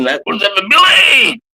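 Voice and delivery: big rising shout, slowed and stretched; excited shout. Real, not acted. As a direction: yell it out to rally the crowd. Rallying viewers to drink together.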